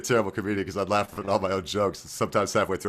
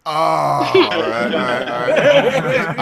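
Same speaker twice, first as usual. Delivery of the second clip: rising, louder laughing all right; 9 semitones higher, 7 dB louder, much slower than usual. Real, not acted. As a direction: chuckle through the words as they climb. Laughs at the Mario pun.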